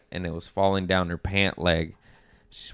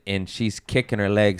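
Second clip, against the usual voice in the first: usual speaking voice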